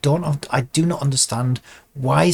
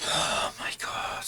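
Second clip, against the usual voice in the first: sighed out softly, almost all breath; exasperated sigh; 12 dB quieter, slower than usual. Real, not acted. Animator to self as software refuses to cooperate, exasperated. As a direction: breathe it out slowly, fed up.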